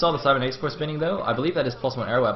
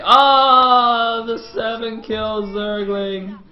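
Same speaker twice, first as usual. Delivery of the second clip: long, high drawn-out wail; slow hammed-up lament, loud then fading; mock mourning; 9 semitones higher, 6 dB louder, much slower than usual. Caster mourning the seven kill Zergling, mock wail.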